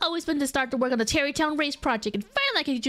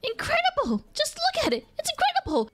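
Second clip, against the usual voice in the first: amazed delight; 13 semitones higher than usual. Acted. Game NPC excited over stick.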